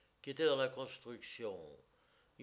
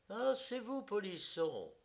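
distracted smile; 9 semitones higher than usual. A narrator's reading. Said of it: "fit-elle avec un sourire distrait".

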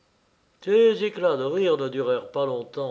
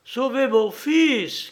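drawn-out, high, loud cry; crying out for help; 10 semitones higher, 5 dB louder, slower than usual. A narrator's reading. Queen crying out for someone to save her son, loud cry. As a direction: cry out in alarm, stretching the words. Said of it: "criait la reine".

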